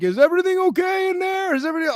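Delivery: high, concerned sing-out; mimicked high-pitched check-in; imitating calling hosts. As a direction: call through a door in a high voice.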